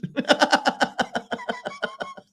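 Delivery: very high shrieking laugh; long high-pitched real cackle. Real, not acted. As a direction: burst into squealing laughter. Cracking up at own joke.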